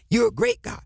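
normal talking voice

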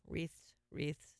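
"Wreaths, wreaths" sounded out softly, word by word; careful wreaths. Shown as a demonstration. Host trying out a tricky word, careful pronunciation. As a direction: test out "wreaths" softly, ending on th-s. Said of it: "I always say wreath wrong".

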